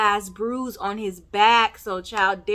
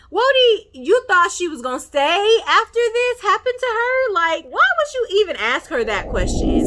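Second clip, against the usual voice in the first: high, loud disbelief; exasperated, high-pitched and loud; incredulous outburst; 12 semitones higher, 6 dB louder than usual. Real, not acted. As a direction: call him out in a raised, incredulous squeal. Exasperated reaction to the character's question.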